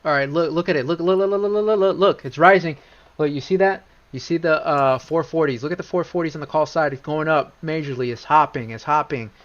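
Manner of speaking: worked up, pitch jumping up then easing; real excitement. Real, not acted. Trader showing viewers that prices are rising, excited. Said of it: "I'm too excited right now".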